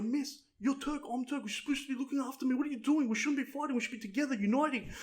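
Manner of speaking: high-pitched appeal; mock pleading. Student appealing to his Turkish teacher, mock pleading.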